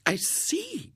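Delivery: very high, severity gone; softened lady. A narrator's reading. Lady believes the twin story, severity vanished. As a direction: say it high, all sternness dropped. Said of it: "Her severity vanished".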